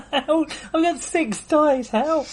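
high, stretched-out cry of disbelief; disbelieving yelp. Real, not acted. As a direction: squeal at the impossible dice. Player stunned by his dice roll.

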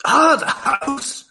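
Scottish, high then whispered; high Scottish exclaim fading to a whisper; sudden Scottish accent. Acted. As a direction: slip into Scottish on the house. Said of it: "Suddenly Scottish".

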